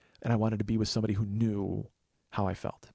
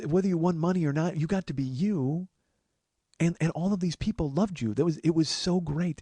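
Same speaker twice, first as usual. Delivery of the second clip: Jill reassuring; 6 semitones higher than usual. Retold or imitated. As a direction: comfort him, a hint of Jill's voice. Jill voiced reassuring him.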